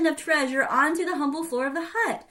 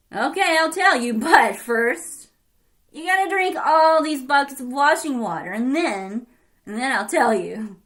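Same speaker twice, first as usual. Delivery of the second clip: cow-washing man voice; 8 dB louder than usual. Acted. Cow-washing man sets a task.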